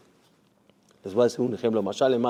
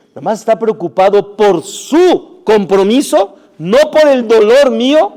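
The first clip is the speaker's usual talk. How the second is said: louder, higher retold protest from the hurt wife; hurt outburst; 8 semitones higher, 10 dB louder than usual. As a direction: let the wife's grievance burst out. Wife's hurt complaint, retold. (esposa dolida que estalla en reproche, más alto)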